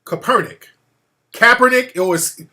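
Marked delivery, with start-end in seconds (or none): 0.1-0.6 Kaepernick stressed on the second syllable; mispronounced name. Shown as a demonstration. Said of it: "I put the emphasis on the wrong". 1.3-1.9 Kaepernick stressed up front, high and loud; questioning pronunciation. Shown as a demonstration. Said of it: "How do you pronounce it?".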